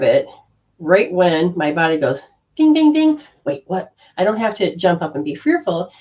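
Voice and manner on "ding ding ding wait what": mock alarm bell. Body's alarm signal.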